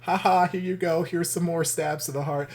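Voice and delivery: lightly voiced gleeful tormentor; mock taunting. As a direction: tease like a gloating villain. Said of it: "And they're like".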